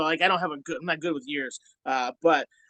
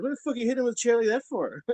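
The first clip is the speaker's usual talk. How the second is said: mock outraged demand, pitched up; indignant quote; 8 semitones higher than usual. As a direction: voice the crowd's angry disbelief. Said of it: "People were like".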